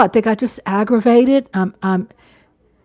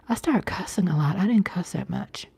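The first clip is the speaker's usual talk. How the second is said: hushed confession; 4 semitones lower, 7 dB quieter than usual. Real, not acted. Confessing her cussing habit.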